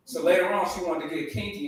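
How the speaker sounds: normal talking voice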